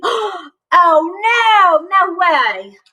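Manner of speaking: high, loud dismayed gasp. Real, not acted. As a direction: react in alarm.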